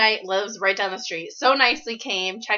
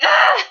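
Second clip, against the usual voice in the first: short high yelp, much higher and louder; retold scream; 8 dB louder than usual. Jolted awake by a noise in the kitchen, high startled yelp. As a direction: yelp "Ah!" in sudden fright. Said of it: "I screamed".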